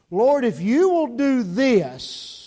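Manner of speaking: loud, stretched-out bargaining plea; disaster praying. Acted. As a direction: mimic a desperate appeal to God. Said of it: "the way our disaster praying sounds".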